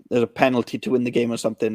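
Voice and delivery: usual speaking voice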